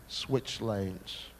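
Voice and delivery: normal talking voice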